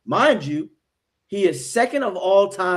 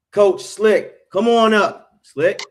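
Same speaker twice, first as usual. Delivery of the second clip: raised, louder hype-man call; hyped introduction; 3 semitones higher, 6 dB louder, slower than usual. Real, not acted. Host brings coach Slick on to the show, hyped introduction. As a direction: call the guest up with a big build-up.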